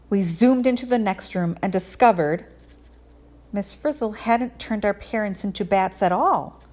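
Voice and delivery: plain reading voice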